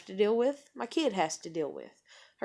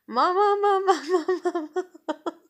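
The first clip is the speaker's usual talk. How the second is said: put-on high baby mama through laughter; imitating baby, laughing; 9 semitones higher, 5 dB louder than usual. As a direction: coo her baby call while laughing. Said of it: "when she'd get kinda irritated".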